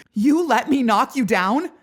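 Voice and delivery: high, loud, panicked disbelief; half hysterical. Acted. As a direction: voice shaking with near hysteria. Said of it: "My voice shakes a half hysterical lilt".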